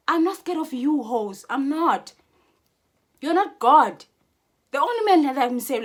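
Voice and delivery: loud, high, defiant yelling; real anger spilling over; angry shout. Real, not acted. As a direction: shout them down in fury. Angry rant at rivals.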